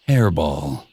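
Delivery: low, embarrassed mumble; sheepish. A narrator's reading. Cat explaining his coughing fit, sheepish. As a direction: admit it, a little ashamed. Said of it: "he said sheepishly".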